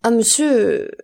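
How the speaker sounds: plaintive cry sinking at the end; anguished plea. A narrator's reading. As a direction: let the plea fall away helplessly. Terrified wife can only plead.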